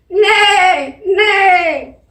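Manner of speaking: high, loud whinnying neigh; loud playful horse neigh for the class. Shown as a demonstration. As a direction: call out like a horse. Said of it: "let me hear your horse sound".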